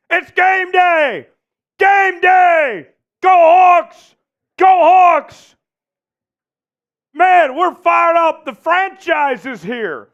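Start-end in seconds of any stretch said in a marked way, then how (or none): 0.1-8.5 loud, high game day cheer; hype shouting. Real, not acted. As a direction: pump up the crowd. Pre-game hype for Seahawks.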